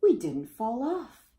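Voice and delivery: put-on bright, proud children's cry; exclaiming. A narrator's reading. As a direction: exclaim it with relief. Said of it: "the children exclaimed".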